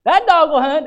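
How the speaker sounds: high, loud Southern churchgoer call at normal pace. Retold or imitated. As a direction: toss it off quickly like a Southern amen. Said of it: "you'll hear that one".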